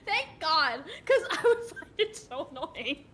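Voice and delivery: high, loud, laughing complaint; laughing exasperation. Real, not acted. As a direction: complain through your laughter.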